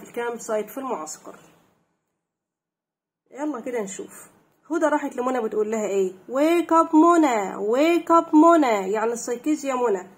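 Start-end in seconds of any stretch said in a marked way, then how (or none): 6.3-8.9 loud, slow, high wake-up call. Acted. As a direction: call out to rouse a sleeping friend, drawing it out. Acting out waking a friend. (نداء عالٍ وممطوط لإيقاظ نائمة)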